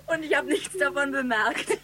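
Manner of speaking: can't believe it, laughing. Acted. Laughing about being fooled.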